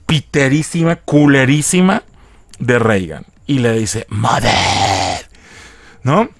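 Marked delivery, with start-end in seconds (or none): 4.1-6.3 grave y ronca, espeluznante; voz ronca de pervertido. Acted. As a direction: haz la voz de niña poseída como pervertido. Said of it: "Porque tiene voz así". (voz ronca y grave de copia barata de Reagan)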